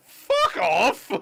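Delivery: loud, high, drawn-out dismissal; mock furious shout. Cornish local to someone renaming their pasties, mock furious shout. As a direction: belt it out as mock outrage. Imagined Cornish reply to outsider.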